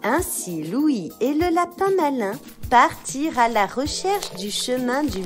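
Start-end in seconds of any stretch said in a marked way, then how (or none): none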